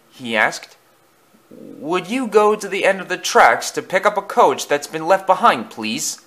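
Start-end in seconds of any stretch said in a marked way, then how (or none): 1.5-6.2 higher-pitched polite request; lightly voiced character, pitched up; station master asking. A narrator's reading.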